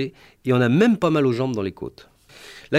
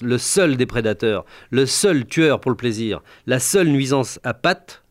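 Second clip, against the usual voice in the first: ordinary delivery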